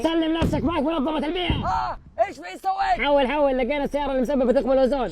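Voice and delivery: loud, high commanding shout; hammed-up arrest call; mock police raid. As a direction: bark orders like a raid squad. Environment agency raid on the car.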